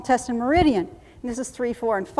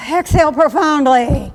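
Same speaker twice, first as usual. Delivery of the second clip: higher, louder breathing cue; loud command; 4 semitones higher, 9 dB louder than usual. Shown as a demonstration. Instructor leads box breathing, loud drill command.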